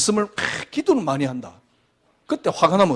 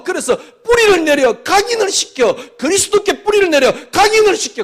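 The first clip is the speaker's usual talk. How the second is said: high, loud sermon climax; fervent preaching; 11 semitones higher, 8 dB louder than usual. Real, not acted. Pastor exhorting his flock, high and loud. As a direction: drive the point home to the congregation.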